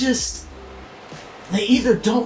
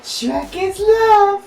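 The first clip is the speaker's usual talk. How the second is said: high, slow drawn-out proclamation; high, slow mock devotion; mock solemn; 9 semitones higher, much slower than usual. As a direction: preach it like a sacred motto.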